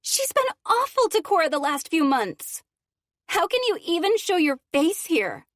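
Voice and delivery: loud, high furious accusing; angry teen. Acted. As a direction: confront her in outrage.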